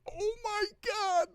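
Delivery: very high, slow, held-back squeal; mock starstruck squeal. Imitating an actress turning back to camera, mock starstruck squeal. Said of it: "like that".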